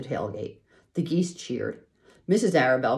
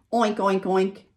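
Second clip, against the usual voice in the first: oinking; 6 semitones higher, 5 dB louder, slower than usual. A narrator's reading. Boy playing a pig.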